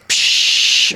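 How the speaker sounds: sharp airy "psh" of escaping air; imitated hiss. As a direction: mimic the rattle hissing. Imitates hiss of rattle in can.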